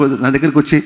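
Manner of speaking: ordinary delivery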